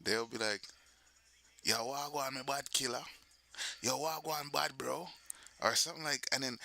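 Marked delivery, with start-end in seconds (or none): 1.6-5.1 put-on Jamaican patois accent; Jamaican greeting. Greeting somebody you see in Jamaica, Jamaican accent. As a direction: hail up a friend in Jamaican patois. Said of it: "But in Jamaica, nah. They'll be like".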